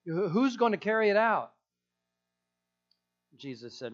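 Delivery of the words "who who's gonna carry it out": higher, louder eager questions; excited angels. Acted. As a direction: gush like curious angels. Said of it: "excited, inquisitive".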